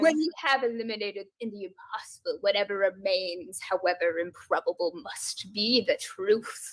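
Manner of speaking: low, soft, measured recital; Sherlock quote, no accent. Acted. Contestant recites Sherlock's maxim in own accent, low and soft. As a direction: recite it low, slowing at the end. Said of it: "I didn't do that in a British accent".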